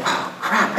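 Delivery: whispered. Retold or imitated. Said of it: "I'm like".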